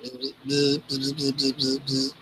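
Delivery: soft, imitated insect buzz; buzzing bug. Ladybug trying to talk to Paloma, soft buzzing. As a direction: buzz quietly in short bursts.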